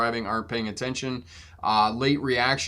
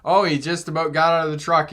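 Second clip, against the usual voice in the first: ordinary delivery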